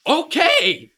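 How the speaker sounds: high exclaimed okay, falling at the end; calling out. Acted. Character in an acted script, calling out okay. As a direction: call it out high and sharp.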